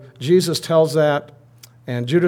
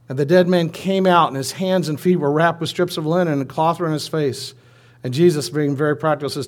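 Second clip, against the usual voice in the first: ordinary talk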